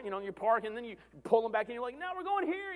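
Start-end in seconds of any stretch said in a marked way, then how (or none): 2.0-2.7 hurried mom. Retold or imitated. Hurrying kids to next game.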